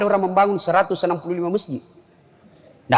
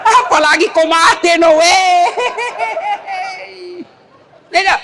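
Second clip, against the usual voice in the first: laughing shout; 8 semitones higher than usual. Acted. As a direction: shout it through laughter, teasing. Joking to wife about remarrying.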